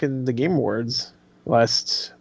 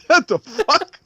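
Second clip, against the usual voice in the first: mock baffled; 11 semitones higher, much slower than usual. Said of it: "That's what he said".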